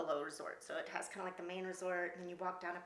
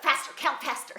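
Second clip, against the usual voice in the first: higher, louder pushing command; urgent command; 8 semitones higher, 13 dB louder than usual. Retold or imitated. Friend in back seat urging her, louder and higher. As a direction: push her to count faster. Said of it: "she's like".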